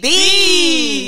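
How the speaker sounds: high, loud, drawn-out shout; catchphrase shout. Pretend. Co-host echoing the podcast catchphrase, playful shout. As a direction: call it out long and high.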